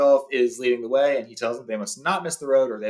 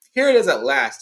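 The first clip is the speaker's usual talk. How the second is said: Gandalf calls out louder to the others; calling out; 2 semitones higher, 7 dB louder than usual. Acted. Gandalf calls the others to the edge, calling out louder. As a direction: raise the voice to call them over. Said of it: "he called".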